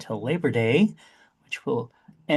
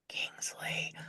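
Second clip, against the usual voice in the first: hushed, very soft whisper; secretive whispered shout-out; whispered name; 16 dB quieter than usual. Pretend. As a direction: drop to a whisper for this one name. Reading patron names, one whispered.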